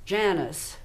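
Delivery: Janice drawn out slowly in a Texan drawl. Shown as a demonstration. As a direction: stretch Janice like a slow Texan. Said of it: "With a Texan drawl".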